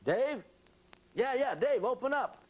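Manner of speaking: very high, urgent pleading at the door; acted frantic door-side plea; frantic caller. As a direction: squeal it, desperate to be let in. Acting out man begging entry.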